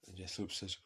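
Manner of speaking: ordinary talk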